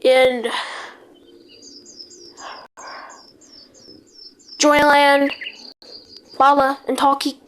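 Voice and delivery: news-anchor alert read, steady and formal; mock emergency broadcast. Gamer announcing a pretend disaster to the server, announcer voice.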